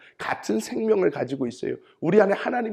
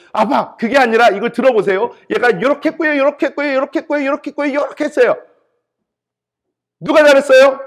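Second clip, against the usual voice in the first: put-on even higher, loud protesting kid voice; imitated rival child; 9 semitones higher, 10 dB louder than usual. Second child countering the sibling to dad, high indignant tattling. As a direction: counter the sibling in a shrieky, high singsong rush. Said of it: "그럼 다른 애가 와가지고요".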